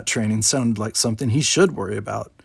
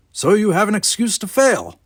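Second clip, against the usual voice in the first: put-on grumpy old man; 9 semitones higher, 5 dB louder than usual.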